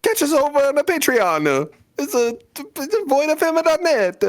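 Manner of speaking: high-pitched cartoon Italian accent. Podcaster doing the Patreon plug on request, Italian accent. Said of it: "in your best Italian accent".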